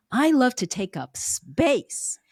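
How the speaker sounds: "space" set apart and drawn out; slow down, stretch out "space"; stress on SPACE. Shown as a demonstration. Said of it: "the emphasis on words".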